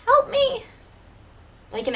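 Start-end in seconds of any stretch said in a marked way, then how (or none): 0.0-0.6 tiny high-pitched squeak, louder; squeaky voice. Acted. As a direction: squeak it out like a shrunken girl. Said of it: "mouth with a squeaky voice that says".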